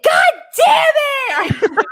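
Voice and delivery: high, drawn-out yell of frustration; long, high-pitched cursing holler; exasperated shout. Retold or imitated. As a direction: relive the outburst at full voice. Retold outburst on hearing restaurant closed.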